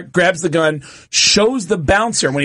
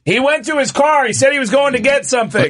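high-pitched voice from the crowd; calling from corner; 10 semitones higher than usual. Retold or imitated. Audience member tells comic where heckler went, high call. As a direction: pipe up high with the news. Someone in corner calls out news.

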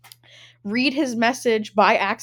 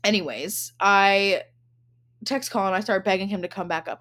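normal talking voice

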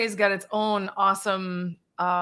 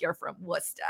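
put-on Massachusetts accent; 3 semitones lower, 11 dB quieter, faster than usual. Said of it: "super, like, Massachusetts about me".

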